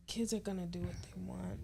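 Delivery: soft unvoiced whisper, a pointed aside. Real, not acted. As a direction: slip it in as a sly whisper. Pointed remark aimed at friend.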